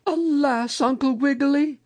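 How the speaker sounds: very high, lamenting lady voice; Mother Goose. A narrator's reading. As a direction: sigh the news out in a high storybook voice. Mother Goose brings bad news.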